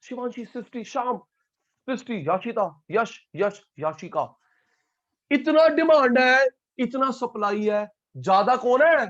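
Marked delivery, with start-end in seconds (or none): 5.3-9.1 higher, louder, slowed insistent question; teacher pressing students, loud and high; emphatic question. Real, not acted. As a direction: push the class for the answer.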